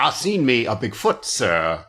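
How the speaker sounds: badly faked country drawl; mock hillbilly accent, dropping low at the end; terrible country accent. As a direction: imitate a hokey fake country accent. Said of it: "he's doing a terrible accent".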